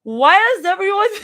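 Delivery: put-on high, loud, fast drunk whine. A narrator's reading. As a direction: slur it like you're tipsy. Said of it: "I love doing drunk voice".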